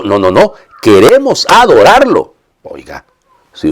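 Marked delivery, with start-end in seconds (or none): 0.8-2.3 high, slow proclamation of "Queremos adorarlo"; emphatic declaration. Acted. Contrasta la intención real de los magos. ("Queremos adorarlo" proclamado con énfasis, agudo y lento)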